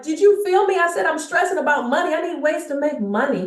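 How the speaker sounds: blurting out money stress in a rush; frantic stressed outburst. Retold or imitated. To her coach, frantic about money and need. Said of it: "I'm like".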